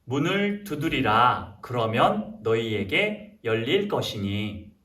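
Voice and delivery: slow, measured Bible recitation; scripture reading. Acted. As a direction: read it out deliberately for children to repeat. Leads children's scripture reading.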